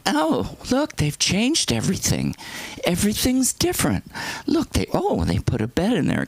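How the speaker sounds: high, fluttery mock wonder; put-on high old-lady voice; passive aggressive mother. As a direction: imitate an old mom pointedly marvelling at changes. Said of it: "she was being passive aggressive".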